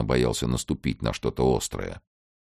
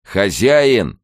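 loud, higher-pitched shout; shouted call; 8 semitones higher, 9 dB louder than usual. A narrator's reading. Lieutenant calling the householder back, shouting. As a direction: call out sharply across the yard. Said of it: "ещё раз крикнул лейтенант".

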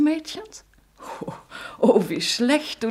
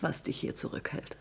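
knowing whisper; 7 semitones lower, 13 dB quieter than usual. Acted. Mother warns her lover.

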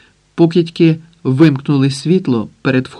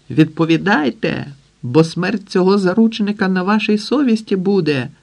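крик; 6 semitones higher than usual. A narrator's reading. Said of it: "кричить механік".

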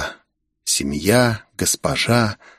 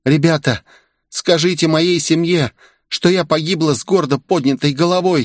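put-on higher girl's voice, resigned farewell; heavy-sighing farewell; 6 semitones higher than usual. Asami bids her team farewell, resigned. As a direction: voice Asami higher, saying goodbye. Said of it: "тяжко вздохнув".

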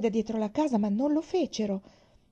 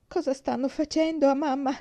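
cry out; 6 semitones higher than usual. A narrator's reading. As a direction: blurt it out in one breath. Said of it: "gridò d'un fiato".

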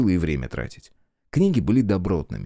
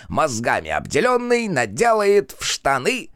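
high, lively verse recital; perky declaiming; 11 semitones higher than usual. A narrator's reading. Cheerful fool runs up reciting a rhyme, perky declaiming. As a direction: recite it in a high, cheeky singsong. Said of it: "Задорно продекламировал подбегающий".

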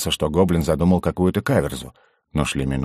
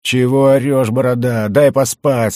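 loud, higher peeved complaint; irritated half-asleep protest; sleepy grumble; 6 semitones higher, 5 dB louder, slower than usual. A narrator's reading. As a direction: grumble back as if woken up. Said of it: "сквозь сон пробурчал Делер".

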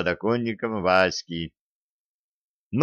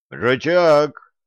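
loud call across the room; loud summoning shout; shouting a name; 6 semitones higher, 5 dB louder than usual. A narrator's reading. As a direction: yell for him to come. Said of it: "заорал юноша".

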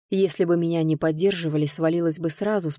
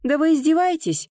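high, indignant outburst; boiling over; 10 semitones higher, 4 dB louder than usual. A narrator's reading. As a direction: flare up in exasperation. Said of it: "вскипела я". (вскипая от возмущения)

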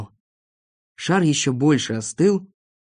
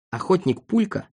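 ordinary delivery, nothing marked

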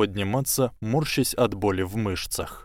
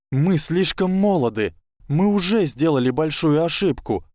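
worried pleading; 6 semitones higher, 3 dB louder than usual. Acted. Hermione warns of danger.

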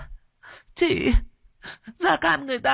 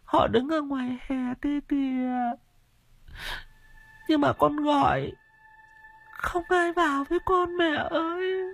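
delirious raving. Acted. Feverish widow calls to her dead husband, high delirious wail. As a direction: rave as if seeing ghosts, pitched far up. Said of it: "mồm nói như sảng".

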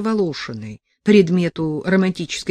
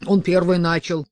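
ordinary delivery

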